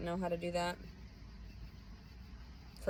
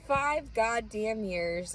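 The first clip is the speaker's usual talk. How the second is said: slow bitter emphasis; 4 semitones higher, 3 dB louder, much slower than usual. Real, not acted.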